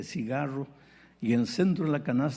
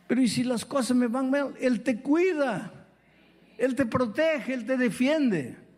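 impassioned preaching; 8 semitones higher than usual. Acted. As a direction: lift the congregation with each promise. Preaching at full force. (predicación fervorosa y elevada)

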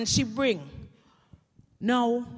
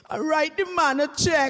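loud, raised punchline; shouted comeback; 4 semitones higher, 7 dB louder than usual. Acted. Widow to nagging friend, exasperated acted shout. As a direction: fire it back at full voice, hammed-up.

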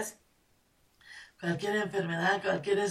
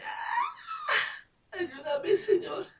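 high, crying plea to God; mother crying out to God in despair; desperate cry; much slower than usual. Retold or imitated. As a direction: wail for help. Crying out outside the door.

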